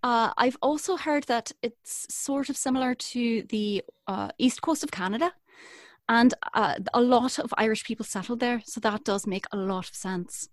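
West Coast Irish accent. Shown as a demonstration. Agreeing it resembles other accents, still in Irish accent. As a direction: keep up the Galway Irish accent. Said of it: "this is sort of a".

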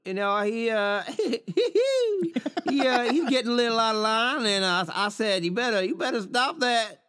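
put-on Texas accent. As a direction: brag about it like a Texas trailer wife. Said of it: "can you do a Texas accent of her".

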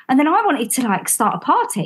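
normal talking voice

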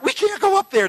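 high, alarmed cry of a frightened pastor; panicked protest. Retold or imitated. Pastor panicking at the bottom of the ramp, high alarmed cry. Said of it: "freaking out".

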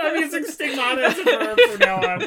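high and loud, still laughing. Real, not acted. Joking with friends about the word, laughing high. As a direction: speak through giggles.

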